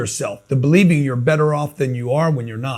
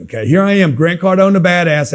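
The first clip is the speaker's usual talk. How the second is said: ordinary delivery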